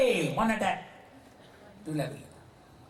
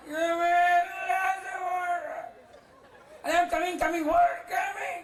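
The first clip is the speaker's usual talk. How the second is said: angry shout; 10 semitones higher than usual. Acted. Sick husband shouting at his wife for taking so long, angry and high. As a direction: bark at her for making him wait.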